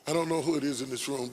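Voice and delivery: usual speaking voice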